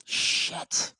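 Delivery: breathy whispered swear; whispered curse. Real, not acted. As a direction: whisper it in disgust. Opponent scores.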